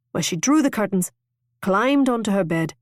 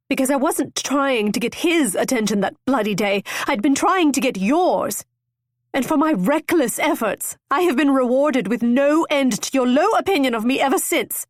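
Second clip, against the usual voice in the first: raised, higher anguished confession; hurt outburst; 6 semitones higher, 3 dB louder than usual. A narrator's reading. Constance confessing to Apthorp, raised hurt anger. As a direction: spill it out in hurt fury.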